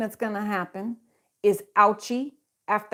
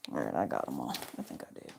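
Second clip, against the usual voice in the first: muttered to self; 14 dB quieter, faster than usual. Real, not acted. Mutters to herself while gathering cards.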